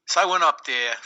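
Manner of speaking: ordinary talk, nothing marked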